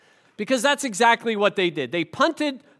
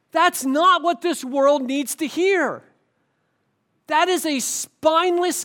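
louder, higher fiery rebuke; real fired-up pulpit scolding; worked up preaching; 4 semitones higher, 3 dB louder than usual. Real, not acted. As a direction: preach it hard with real heat. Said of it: "I'm getting all worked up".

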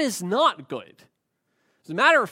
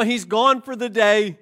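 usual speaking voice

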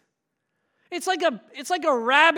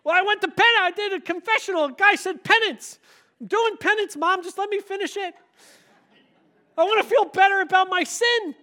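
a hint of a pleading child; lightly voiced kid pleading his case; sheepish kid; 6 semitones higher, 4 dB louder, faster than usual. Son explains his penance to mom, lightly pleading.

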